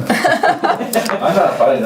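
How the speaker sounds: laughing. Real, not acted. Teasing quarrel about the door.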